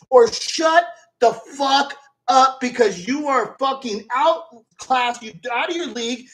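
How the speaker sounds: normal talking voice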